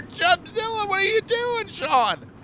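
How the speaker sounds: ordinary talk, nothing marked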